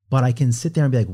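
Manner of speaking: ordinary delivery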